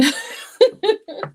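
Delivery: a high, self-mocking giggle; self-mocking laugh. Real, not acted. Laughing at herself for being the emotional Gemini.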